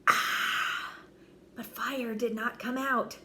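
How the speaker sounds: plain reading voice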